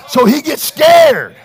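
loud, high, urgent pulpit shout; full-force preaching. Real, not acted. Preacher warns church about the scared devil, full-force preaching. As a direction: drive it home like a revival preacher.

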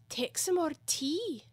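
high, slow earnest offer. A narrator's reading. March Hare offers Alice tea, very earnestly. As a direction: press it on the guest sincerely. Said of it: "said to Alice very earnestly".

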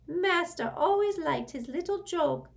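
high, loud servile elf; sarcastic house-elf. A narrator's reading. House-elf answers Sirius's jibe, high and sourly servile. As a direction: fawn with a sour edge.

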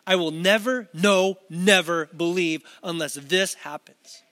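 slow, punched NEVER, NO, NEVER; deliberate, insistent refusal; emphatic. Retold or imitated. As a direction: lean hard on each never and the no between. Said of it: "it's emphatic".

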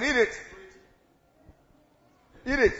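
loud, short command; forceful devil's order; devil shouting. Acted. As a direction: bellow it like an insistent tempter. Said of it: "then the devil says".

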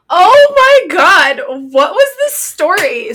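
loud, high and slow, stretched in shock; shocked disbelief. Real, not acted. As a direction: she can't believe what she hears. Shocked reaction to story.

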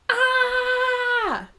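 high gasped ah, read from her notes; written ah yelped. Retold or imitated. Reader squealing about the Raiders showing up. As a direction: squeal it like she did reading it. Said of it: "took a moment to write".

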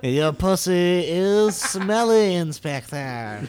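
slow, sneering French villain voice; mustache twirling villain. Acted. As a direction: drawl it like a cartoon villain. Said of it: "this French inspector".

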